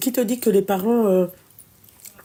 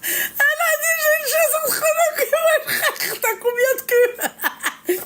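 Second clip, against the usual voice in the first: high laughing mock retelling; cracking up while quoting her; squealing with laughter; slower than usual. Real, not acted. Mocking friend's phrase, laughing.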